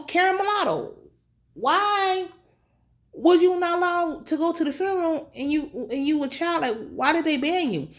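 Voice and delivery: high, quizzical imitation of R C; quoting R C. Recapping the sister asking why she was banned, high and prying. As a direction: pitch it up and press her for the story. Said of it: "R C asked her".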